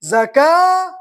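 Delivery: usual speaking voice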